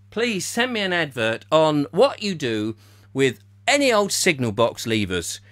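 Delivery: usual speaking voice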